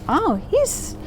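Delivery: high, lightly acted swoon; smitten princess. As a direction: play a princess taken with him. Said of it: "And she's like".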